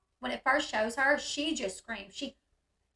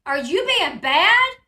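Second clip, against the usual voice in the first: loud, slow, raised-pitch mock scolding; imitated yelling; 5 semitones higher, 11 dB louder, slower than usual. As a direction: mimic a woman yelling at a child. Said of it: "she yells at him".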